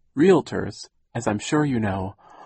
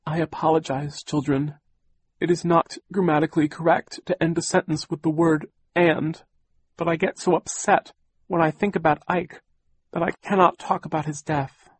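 higher, tearful apology; fighting back tears, a bit higher; 7 semitones higher than usual. Acted. Aunt apologizing tearfully to the children. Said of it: "tears came to Aunt Josephine's eyes".

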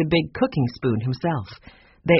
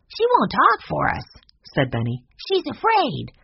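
put-on much higher, bright little boy voice; young Benny; 11 semitones higher than usual. A narrator's reading. Benny explaining why the bird won't talk, high boyish voice. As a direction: pipe up like a small boy.

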